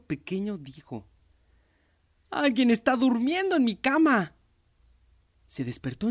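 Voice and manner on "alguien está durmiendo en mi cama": very high, loud squeaky cub voice; little bear voice. Acted. Baby bear discovers the girl in his bed, a high loud squeak. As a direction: pipe it up like a tiny startled cub.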